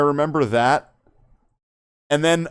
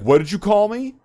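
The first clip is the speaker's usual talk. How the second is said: higher, a touch angry what did you call me; mock angry challenge; 7 semitones higher than usual. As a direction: imitate a pissed-off boxer squaring up. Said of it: "Mike Tyson gets all pissed off".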